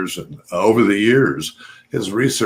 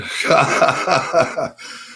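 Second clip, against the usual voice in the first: laugh; 8 semitones higher than usual. Real, not acted. Laughs at his mispronunciation.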